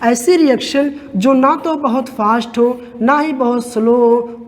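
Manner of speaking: usual speaking voice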